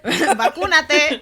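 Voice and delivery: "Vacúnate" slow, Spanish vowels, stress on cú; "Vacúnate" drawn out with a laugh; Spanish word. Shown as a demonstration. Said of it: "that's the word in Spanish".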